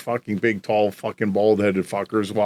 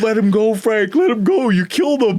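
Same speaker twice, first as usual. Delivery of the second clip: higher, louder panicked cries to pull him off; retold bystander shouts; 9 semitones higher, 4 dB louder than usual. Bystanders pleading with Frank to stop beating him, raised mock shout. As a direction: call out as if begging him to stop. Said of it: "And then they're like".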